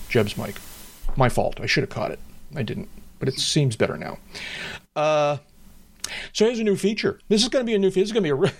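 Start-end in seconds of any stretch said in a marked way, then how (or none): 6.3-8.5 high, playful mock announcement; facetious. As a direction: tease a grand new feature. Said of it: "I'm being facetious".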